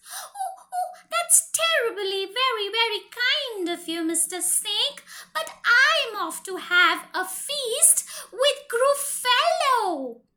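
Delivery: high, bright mouse voice with a laugh; squeaky mouse. Acted. Mouse turns down Mister Snake's feast in a high squeak. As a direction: squeak politely like a clever little mouse.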